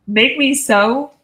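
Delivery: normal talking voice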